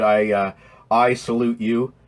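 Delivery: ordinary delivery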